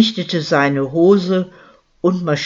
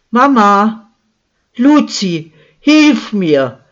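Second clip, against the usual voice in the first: high, loud cry with her last strength; desperate cry for help; 5 semitones higher, 6 dB louder, slower than usual. A narrator's reading. Margarete, attacked, calls her daughter for help: high loud cry. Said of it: "rief Frau Schäfer mit letzter Kraft".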